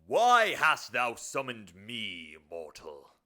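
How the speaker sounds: loud, slow, drawn-out demon bellow; summoned demon. Acted. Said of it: "This will be the demon".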